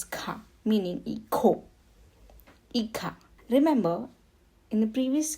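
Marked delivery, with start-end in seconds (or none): 0.0-0.4 "ka" with the J as a throaty kh; Spanish JA spoken as "kha"; J pronounced as kh. Shown as a demonstration. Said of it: "J A is pronounced as". 1.0-3.1 "hijo" as ee-kho, "Hija" as ee-kha; J pronounced as kh. Shown as a demonstration. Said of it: "J A is pronounced as ka".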